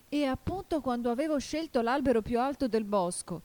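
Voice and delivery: high-pitched despairing complaint; desperate cry. A narrator's reading. Pigeon had chosen the tallest tree, desperate cry. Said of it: "con un grido disperato".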